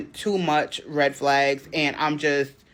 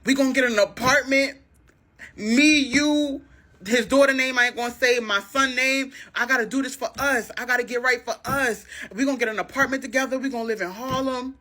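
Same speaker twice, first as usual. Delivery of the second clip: higher, earnest pleading voice; pleading boyfriend; 8 semitones higher than usual. Retold or imitated. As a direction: sell her the dream, begging. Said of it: "So he like".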